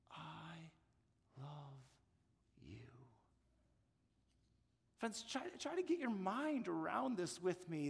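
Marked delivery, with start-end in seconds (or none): 0.1-2.9 much softer, slow, word by word; hushed I love you. Shown as a demonstration. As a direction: pause between each word, tenderly. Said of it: "quoting God intimately".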